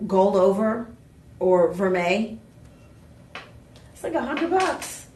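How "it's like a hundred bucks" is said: ordinary talk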